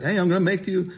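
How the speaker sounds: bad Jerry Seinfeld. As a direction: slip into a bad, high-pitched comic impersonation. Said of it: "like a bad Jerry Seinfeld impersonation".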